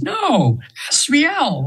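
lilting high voice of the angel. Retold or imitated. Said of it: "I'm almost using her voice now".

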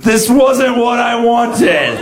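long, high-pitched anguished wail; imitated scream. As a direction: scream it slowly like a performer whose act just failed. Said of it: "and she just screamed".

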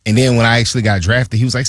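ordinary talk, nothing marked

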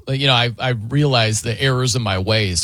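ordinary talk, nothing marked

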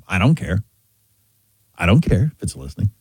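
low-pitched imitation of indifference; indifferent young people. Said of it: "young people say this all the time".

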